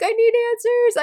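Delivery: high-pitched mock-desperate demand; desperate plea. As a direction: plead up high, like an impatient fan. Acting out her demand for answers.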